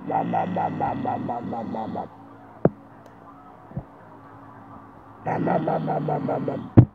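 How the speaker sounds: deep growling monster sound; monster noise. Pretend. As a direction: rumble it low like a beast. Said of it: "A monster".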